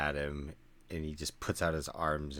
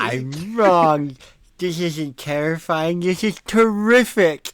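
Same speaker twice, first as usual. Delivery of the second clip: high, very loud hammed-up holler; exhilarated character shout; 10 semitones higher, 17 dB louder than usual. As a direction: shout it out, thrilled by a wild ride. Voicing character spinning in circles.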